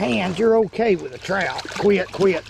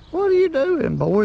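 talking to a fish; 13 semitones higher, 3 dB louder than usual. Real, not acted.

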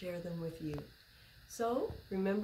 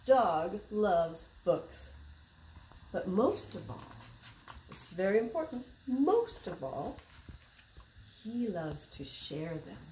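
lively, slow picture-book reading voice; reading to children; slower than usual. A narrator's reading. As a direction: read it like story time for little kids.

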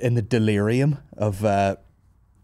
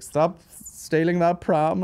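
slow, high teasing scold; mock scolding; 7 semitones higher, much slower than usual. Wife teasing him about stealing his own pram, slow high mock scold. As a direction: drag it out like a joking reprimand. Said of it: "[name]'s joking around".

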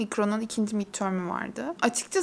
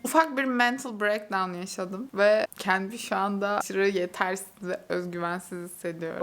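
usual speaking voice

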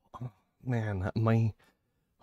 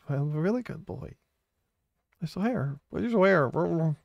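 higher pleading pet voice; begging good boy; 4 semitones higher than usual. Pretend. Streamer begging chat to call him good, in a whiny pet voice. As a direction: beg sweetly to be called good.

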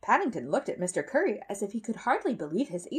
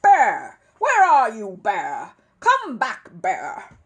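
loud, pained calling out; furious yelling; 3 semitones higher, 12 dB louder, much slower than usual. A narrator's reading. Neighbour shouts for the bear to come back, loud. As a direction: bellow after him across the garden.

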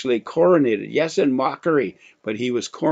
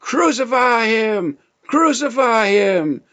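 loud, much higher, slowed shout; frenzied crowd shout; 11 semitones higher, 6 dB louder, slower than usual. Retold or imitated. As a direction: scream it like a mob baying for blood. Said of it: "the leadership cried out".

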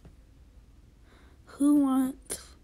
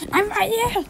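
much higher, louder call to be found; 11 semitones higher, 5 dB louder than usual. Real, not acted. Player shouting his location to a teammate. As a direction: shout so the friend hears you.

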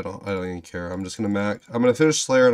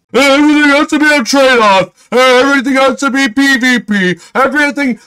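sneering mock voice, pitched up and loud; mocking developers; 7 semitones higher, 4 dB louder than usual. Player mimicking Jagex developers, scornful mock voice. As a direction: parody their smug design line.

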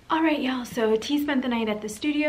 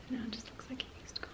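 soft, quick whisper; 3 semitones higher, 13 dB quieter, faster than usual. Real, not acted. Prankster showing the camera her fake evidence, whispering. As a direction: whisper it under your breath.

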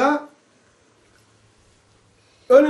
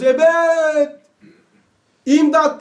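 ebed and imdat called out higher and louder; crying out; 5 semitones higher, 4 dB louder, much slower than usual. Acted. Preacher shows the soul calling for eternity, crying out. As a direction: cry out to the heavens for help. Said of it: "bağırıyoruz".

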